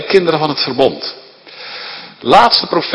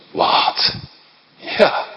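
ordinary delivery

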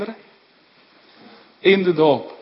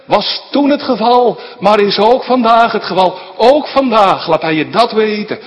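louder, higher, driving preacher voice; fervent preaching; 6 semitones higher, 6 dB louder than usual. Acted. Pastor urging parents at a baptism, fervent and loud. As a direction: press the message home from the pulpit.

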